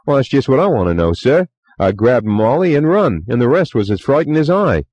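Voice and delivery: put-on chatty young bellboy, eager to please; Cockney bellboy. A narrator's reading. Bellboy Thomas voiced.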